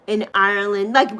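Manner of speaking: usual speaking voice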